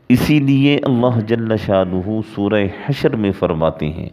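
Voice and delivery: usual speaking voice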